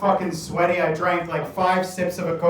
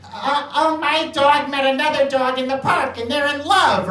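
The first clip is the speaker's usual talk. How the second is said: a hint of chirpy, bored small talk, pitched up; mock small talk; 6 semitones higher than usual. Mocking relatives' holiday small talk, pitched up. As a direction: lightly parody the dull chatter. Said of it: "The little conversations that are just".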